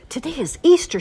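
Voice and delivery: put-on high, bright-eyed little girl voice; excited girl. Olivia waking on Easter morning, excited. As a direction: Olivia bursting with morning news. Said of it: "she said".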